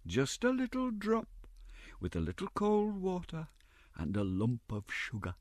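high, soft cajoling; tempting Mrs Mann. A narrator's reading. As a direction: dangle the treat sweetly.

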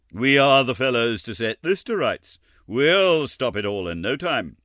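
pleased with their own cleverness; knowing board. Acted. Board members resolving to fix the workhouse, smug. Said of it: "looking very knowing".

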